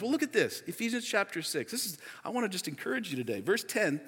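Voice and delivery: usual speaking voice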